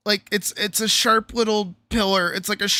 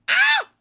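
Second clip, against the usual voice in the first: sharp high yelp of pain; loud real yelp of pain; 6 dB louder than usual. Real, not acted. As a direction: cry out at a sudden sting. Knee scraped, sudden pain.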